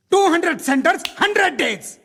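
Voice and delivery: high, punched announcer slogan; mock film promo. Officer ridiculing violent film hits, mock film promo. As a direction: trumpet the box-office boast mockingly.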